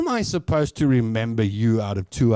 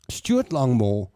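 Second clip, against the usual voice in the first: ordinary talk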